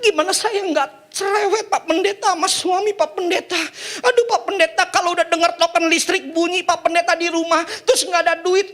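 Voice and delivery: high, laughing, whiny wife voice; complaining wife. Acted. A wife complains to the pastor about her husband, high and whiny. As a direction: plead with the pastor, exasperated. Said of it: "Lalu istrinya ngomong".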